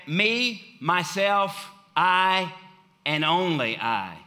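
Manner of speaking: "Me, myself, I" each set apart and stressed; extremely emphatic. Retold or imitated. Preacher paraphrasing Jesus, "Me, myself, I" hit emphatically one by one. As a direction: punch each of "Me", "myself", "I" as its own beat. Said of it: "he was extremely emphatic about it".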